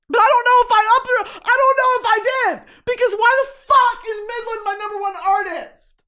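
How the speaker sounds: high, loud incredulous rant; exasperated outburst. Real, not acted. Exasperated that Midland tops her listening year. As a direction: rant it out in disbelief. Outraged disbelief at ranking.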